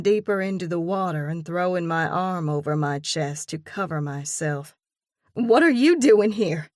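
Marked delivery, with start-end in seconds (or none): 5.3-6.7 loud high shocked question; shocked outburst, stress on you; startled demand. A narrator's reading. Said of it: "I screech".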